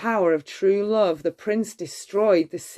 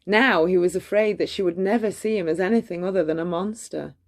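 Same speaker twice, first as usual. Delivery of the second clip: ordinary delivery, nothing marked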